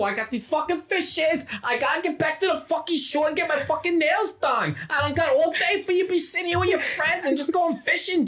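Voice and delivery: very high, mock-female Boston accent; hammed-up shrieky Boston wife; nagging Boston wife. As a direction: nag your husband in a squeaky put-on voice. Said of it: "Jason Statham's wife from fucking Boston".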